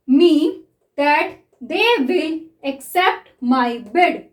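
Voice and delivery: very slow, even dictation reading. Shown as a demonstration. Teacher reads a shorthand passage to students, very slowly. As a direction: read it out word by word for writing.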